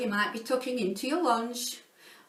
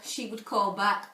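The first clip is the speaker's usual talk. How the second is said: ordinary delivery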